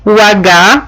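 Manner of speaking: ordinary delivery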